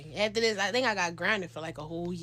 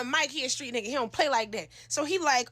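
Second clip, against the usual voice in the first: plain reading voice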